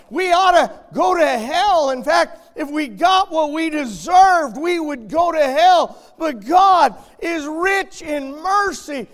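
loud, slow, high-pitched fire-and-brimstone preaching; preaching at full force. Acted. Preacher warning the congregation of hell, in full-force preaching. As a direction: thunder each word of warning out over the church.

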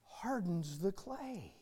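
ordinary talk, nothing marked

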